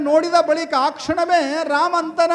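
high, loud, ringing narration; full-force story preaching. Acted. Preacher telling of young Rama seeing moonlight, full-force preaching.

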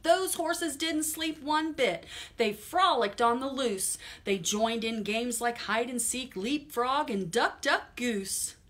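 put-on lively read-aloud to children; storytime reading. Teacher reading a picture book to kids, lively storytime voice. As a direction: read it brightly to little listeners.

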